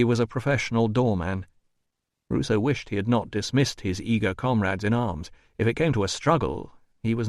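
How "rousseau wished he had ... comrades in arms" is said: plain reading voice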